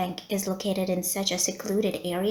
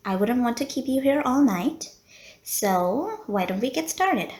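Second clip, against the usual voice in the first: ordinary talk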